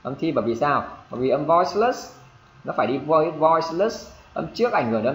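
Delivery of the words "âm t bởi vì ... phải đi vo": ordinary talk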